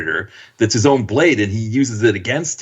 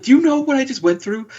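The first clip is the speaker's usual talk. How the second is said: high, indignant mock whine; hammed-up indignant squeal; mock outrage; 13 semitones higher than usual. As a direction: play the exasperated soldier, pitched up. Mock Adam Baldwin complaint.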